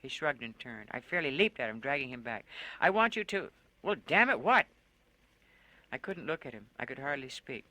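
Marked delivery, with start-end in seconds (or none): 2.8-4.7 loud, higher, groping for words; frustrated outburst. Acted. Woman drags Lone back and bursts out, loud and exasperated. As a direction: blurt it out in frustration.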